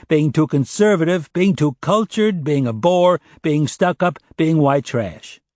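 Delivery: high, insistent run of short items; exasperated listing. Acted. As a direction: pile up grievance after grievance, pitched high. Narrator voices Oliver's exasperated list.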